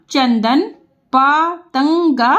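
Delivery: पतंगा drawn out slowly, each part separate; syllable by syllable. Shown as a demonstration. Teacher to pupils, spelling out पतंगा slowly. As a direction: sound out पतंगा slowly for the class.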